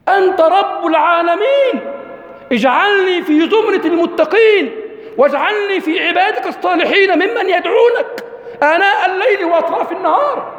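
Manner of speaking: high, fervent prayer voice; fervent prayer of Abraham. Acted. Preacher voicing Abraham's prayer, high and fervent. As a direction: cry out the prayer to the Lord. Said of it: "كأن إبراهيم يقول".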